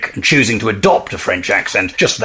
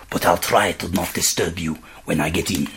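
put-on low, soft French accent; 7 semitones lower, 8 dB quieter than usual. A narrator's reading. Frenchman promising not to disturb roommates, soft French accent. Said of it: "he still chose to keep the French accent".

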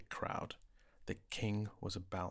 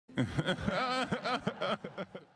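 high mocking royal laugh; king's laugh; 11 semitones higher, 4 dB louder than usual. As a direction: laugh at his expense, scheming.

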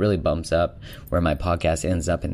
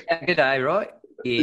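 mock Australian greeting, pitched up; amateur Australian accent, higher than usual; 8 semitones higher than usual. Shown as a demonstration. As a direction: put on a rough attempt at an Australian accent. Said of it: "Probably better than my Australian accent".

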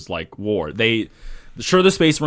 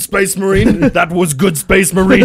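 higher, louder gruff commander voice; gruff space marine; 9 semitones higher, 8 dB louder than usual. Pretend. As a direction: bark praise like a proud officer. Voicing commander praising marine.

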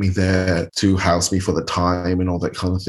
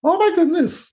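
much higher, gushing Oh my goodness; amazed. Retold or imitated. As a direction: gush over the makeup. Everybody amazed at makeup.

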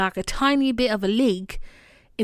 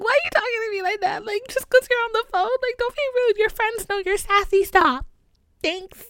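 very high, squeaky mock protest; mock whining; 14 semitones higher, 4 dB louder than usual. Daughter scolds her mother for being rude on the phone, mock whining. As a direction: whine it way up high and fast.

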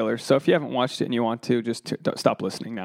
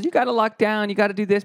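higher, preachy smug-couple voice; mocking married couple; 7 semitones higher, faster than usual. Comic mimicking married friends advising singles, higher and preachy. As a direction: nag like a know-it-all married friend. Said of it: "they tell other couples".

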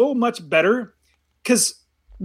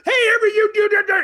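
high, garbled parental yell; 14 semitones higher than usual. Retold or imitated. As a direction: imitate a nagging parent hollering. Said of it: "and you start yelling".

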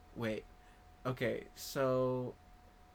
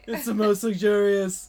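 louder boastful Trump voice; Trump impression; 6 semitones higher, 11 dB louder than usual. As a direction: brag like Trump, punchy and loud. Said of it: "me doing Kyle Dunnigan's impression of Trump".

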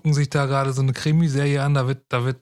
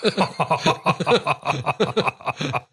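1 laughing. Real, not acted. Laughing at own joke.

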